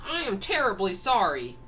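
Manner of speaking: high-pitched acted apology; profuse apology. Character apologises to angry drivers.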